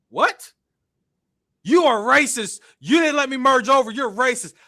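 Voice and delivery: high, loud mock yell of the other driver; mock angry accuser. Retelling the other driver shouting at him, mock yell.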